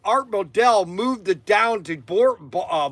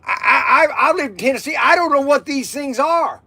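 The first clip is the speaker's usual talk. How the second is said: confused Tennessee sailor; 7 semitones higher than usual. Retold or imitated. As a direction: play the baffled recruit, pitched up. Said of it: "I go".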